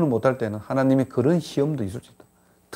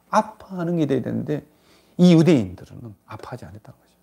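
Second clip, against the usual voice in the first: ordinary talk, nothing marked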